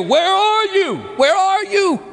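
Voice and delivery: high, ringing call of God. Acted. God calling to the hiding sinner, high and searching. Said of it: "he's gonna ask you".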